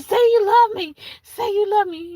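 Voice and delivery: put-on needy crazy girlfriend. Crazy girl demands love.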